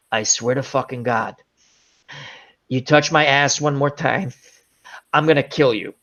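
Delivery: low, measured mock threat; threatening snake. Snake warning the two men grabbing it, mock threatening. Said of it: "turns and looks at him and goes".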